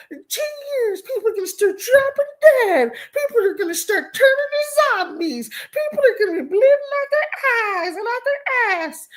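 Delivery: very high, wailing mock panic; hammed-up hysterical doomsayer; mock fearmongering. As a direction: imitate alarmists in a squealing high voice. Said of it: "saying all kinds of fear-mongering stuff".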